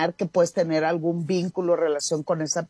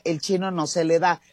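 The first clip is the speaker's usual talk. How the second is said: ordinary talk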